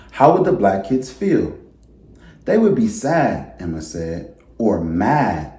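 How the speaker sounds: gentle storybook read-aloud voice; reading to children. A narrator's reading. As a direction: read it like story time for a class. Teacher reads picture book aloud.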